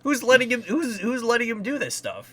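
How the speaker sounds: loud, high incredulous outburst; exasperated disbelief. Acted. Acted exasperated reaction to the voice.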